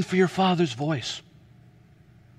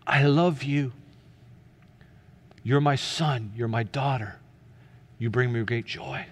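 lower, softening tender reassurance; still small voice; 4 semitones lower than usual. Acted. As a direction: answer calmly as a patient father, pausing between phrases. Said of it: "what that still small voice says".

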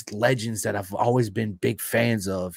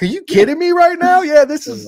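much higher and louder, starstruck; stunned disbelief; 10 dB louder than usual. Retold or imitated. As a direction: gasp it out like you can't believe your eyes. Meeting his hero by surprise.

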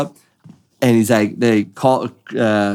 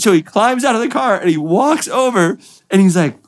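ordinary talk

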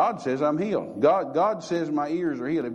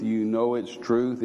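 ordinary delivery

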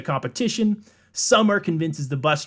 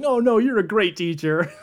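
mock reassuring; 7 semitones higher, 3 dB louder than usual. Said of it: "She's like".

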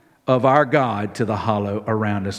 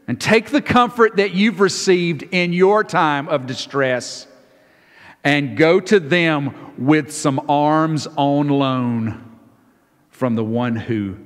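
ordinary delivery

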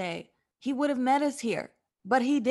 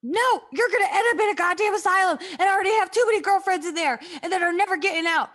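louder, higher angry rant; heated rant at Anne; shouted insults; 7 semitones higher, 6 dB louder, faster than usual. Acted. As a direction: yell her down.

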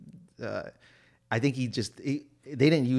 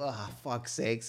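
muttered; 9 dB quieter, slower than usual. Retold or imitated. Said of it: "I go".

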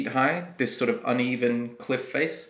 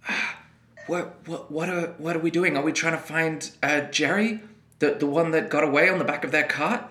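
nervous villager; 4 semitones higher than usual. Acted. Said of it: "they say".

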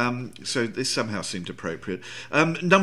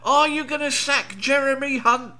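much higher, louder reporter shout; shouted question; 12 semitones higher, 5 dB louder than usual. Retold or imitated. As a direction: yell it across the barrier. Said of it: "journalists shouting out questions".

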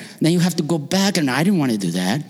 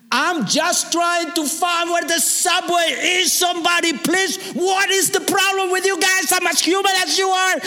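screaming in the street; 14 semitones higher than usual. Retold or imitated. Ignored tourist begging the crowd, high desperate scream. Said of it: "I'm just screaming".

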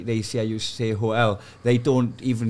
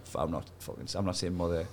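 much softer, low defiant mutter; muttered refusal; 7 semitones lower, 12 dB quieter than usual. Retold or imitated. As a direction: grumble under your breath. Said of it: "And I'm like".

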